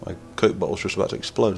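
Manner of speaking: ordinary talk, nothing marked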